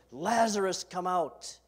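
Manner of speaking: calling out loudly to a dead man. Acted. Jesus calling Lazarus from the tomb, loud ringing call. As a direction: boom it out as a summons. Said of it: "Jesus calls out in a loud voice".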